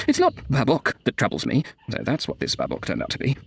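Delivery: ordinary delivery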